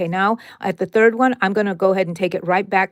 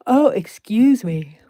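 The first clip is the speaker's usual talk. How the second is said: yawning; 2 semitones higher, 5 dB louder, slower than usual. Real, not acted. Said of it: "Yawning, yawning".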